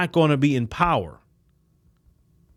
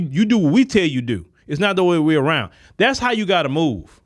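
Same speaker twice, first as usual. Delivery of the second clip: normal talking voice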